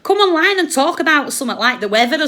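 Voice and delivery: raging order to zip it, fast at the end; angry shout. Real, not acted. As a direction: blast her to shut up. Angry rant climax.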